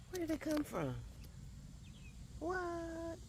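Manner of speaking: high-pitched, soft surprise; amazed. Real, not acted. Gardener finding an unexpected squash, amazed. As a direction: marvel softly, voice lifted way up.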